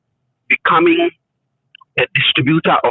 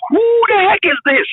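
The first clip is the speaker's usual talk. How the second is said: amazed question. Retold or imitated. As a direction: exclaim in wonder at a brilliant stranger. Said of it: "I was like".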